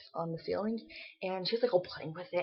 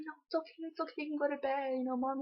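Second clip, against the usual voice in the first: high, soft coaxing voice; retold soothing; 8 semitones higher, 4 dB quieter, faster than usual. Rocking a crying child toward sleep, imitated soft reassurance. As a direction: gently hush an upset little one.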